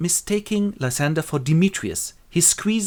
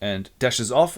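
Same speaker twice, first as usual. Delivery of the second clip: ordinary delivery, nothing marked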